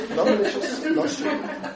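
light self-mocking mantra with a laugh; sing-song mantra. Shown as a demonstration. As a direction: chant it to yourself playfully. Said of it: "I say to myself".

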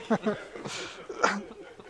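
breathy chuckling laugh; real, spontaneous laughter; amused laugh. Real, not acted. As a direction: chuckle at the absurd offer. Amused at the hare's offer.

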